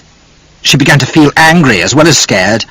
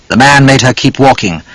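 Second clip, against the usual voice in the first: ordinary delivery, nothing marked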